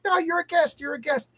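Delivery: high-pitched imitation of fussing hosts; polite brush-off. Retells hosts refusing his help.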